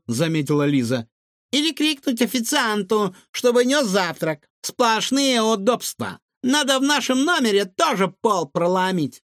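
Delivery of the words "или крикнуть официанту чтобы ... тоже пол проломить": put-on high, lively child's voice; cheeky girl Liza. A narrator's reading. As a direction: play an eager little girl, high-pitched.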